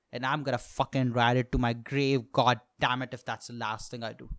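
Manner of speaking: normal talking voice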